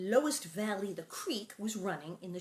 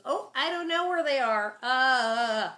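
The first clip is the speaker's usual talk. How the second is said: evasive Pa; 4 semitones higher, 8 dB louder than usual. Retold or imitated. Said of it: "And Paul goes".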